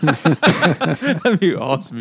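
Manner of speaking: laughing. Real, not acted. Laughing at nudist idea.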